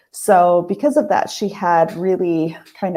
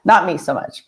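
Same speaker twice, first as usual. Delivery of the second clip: normal talking voice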